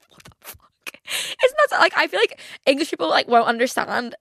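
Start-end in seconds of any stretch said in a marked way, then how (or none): 0.1-0.9 barely voiced, stunned whisper; whispered disbelief. Vlogger recalling a stranger's question, whispering in shock. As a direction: mouth it in disbelief.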